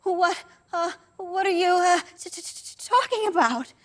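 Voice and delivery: high, panicky stammer; frightened son stammering at his mother; stuttering. Acted. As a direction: trip over the t again and again. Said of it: "as I stuttered".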